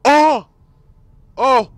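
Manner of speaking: loud, high yelp of shock; surprised yelp. Real, not acted. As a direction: jump at the loot. Big loot find.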